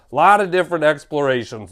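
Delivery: ordinary talk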